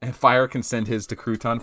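usual speaking voice

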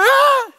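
high cartoon scream; mock scream. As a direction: shriek at the spider for fun. Screaming at the spider.

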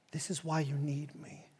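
very soft, low near-whisper; compassionate. Acted. Jesus to Peter after his denial, hushed and compassionate. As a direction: say it gently, almost under the breath. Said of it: "but just a compassion saying".

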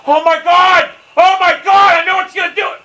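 high, loud cries of dismay; alarmed yelling. Real, not acted. Gamer watching the ball about to roll off, alarmed yell. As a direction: cry out as it goes wrong.

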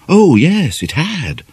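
usual speaking voice

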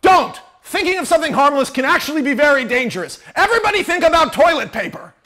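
loud, fast, frantic correction; urgent shout. Acted. Ray overruling Becker in the storm, fast loud shout. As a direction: cut them off loudly and rush it. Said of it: "And then Ray goes".

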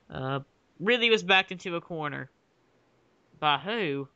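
normal talking voice

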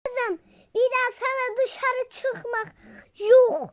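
stern mother. Pretend. Mother forbids daughter from going out, stern then loud. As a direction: lay down the law to your daughter.

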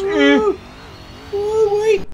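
high squeal. Real, not acted. Sudden high squeal in game.

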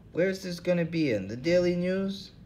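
put-on old hobo, voice pitched well up. Hobo Lou Jenkins to the interviewers, in a high put-on voice. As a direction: play the suspicious bum, pitched high.

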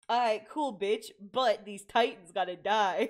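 loud, low mock-tough declaration; joking Eren voice. Reactor paraphrasing the hero, mock-tough and loud. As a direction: play a hero brushing off romance, loudly. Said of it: "He said".

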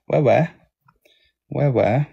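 quick doubled "ouais ouais", open "weh-weh"; Québécois ouais. Shown as a demonstration. Streamer mimicking Québécois yes, doubled "ouais ouais" as "weh-weh". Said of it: "they say ouais".

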